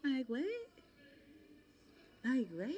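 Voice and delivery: high incredulous like what; shrieking disbelief, high up. Real, not acted. Disbelief at the guy.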